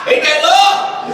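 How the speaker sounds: high, exclaimed rhetorical question; jubilant holler. Real, not acted. Preacher celebrating the brothers' teasing as love, high jubilant holler. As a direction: holler it out, pitch well up.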